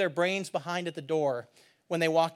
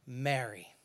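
soft, low call by name; tender naming; 7 semitones lower, 9 dB quieter than usual. Acted. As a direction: say the name gently, dropping right down. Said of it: "Jesus said to her".

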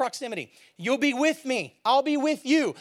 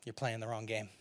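soft intimate preaching; 10 dB quieter than usual. Acted.

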